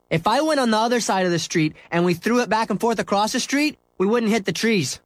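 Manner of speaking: put-on high, eager kid voice; a young boy proposing a game; boy's voice. Boy suggests throwing ball across street, high kid voice.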